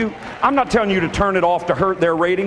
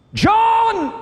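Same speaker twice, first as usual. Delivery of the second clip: urgent cry of John; 3 dB louder than usual. Retold or imitated. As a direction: cry out the name John high and loud. Mother calling her son urgently.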